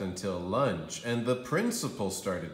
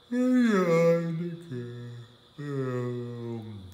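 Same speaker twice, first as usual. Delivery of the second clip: big yawn; 3 semitones higher, 4 dB louder, much slower than usual. A narrator's reading. Narrator acting out Andrew's yawn, big loud yawn. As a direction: yawn the words out.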